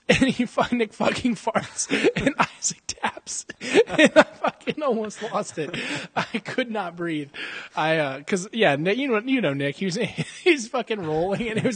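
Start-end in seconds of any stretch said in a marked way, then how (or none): 0.1-7.3 high, slow, breathless laughing; helpless laughter. Real, not acted. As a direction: barely get the words out for laughing. Laughing so hard can't breathe.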